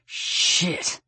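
a breathy groaned curse; hoarse groan. A narrator's reading. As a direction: let the word die in a rough groan. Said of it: "his voice trailed to a hoarse groan".

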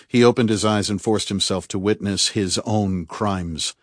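ordinary delivery

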